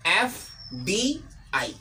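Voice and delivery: एफ़डीआई spelled out slowly as separate letters, एफ़ डी आई; very slowly say each letter of एफ़डीआई on its own. Shown as a demonstration. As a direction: read एफ़डीआई letter by letter at a crawl. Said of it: "अगर ये अलग-अलग है तो देखिए इसको पढ़ेंगे".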